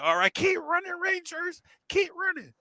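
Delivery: hammed-up frantic urging in falsetto; mock panicked call. Instructor urging Rangers on while fleeing bees, mock panic.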